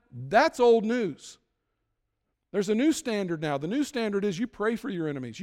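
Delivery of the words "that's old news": drawn out slowly and a bit louder, dismissive; slow emphatic. Real, not acted. Preacher dismissing old teaching.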